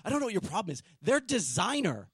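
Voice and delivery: lightly mocking the indignant girl; imitating the girl. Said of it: "she's like".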